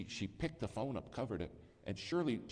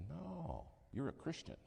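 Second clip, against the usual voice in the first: high, softer correcting voice; secretary's gentle rebuke; 9 semitones higher, 5 dB quieter, slower than usual. Retold or imitated. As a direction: set him straight, a pitch higher.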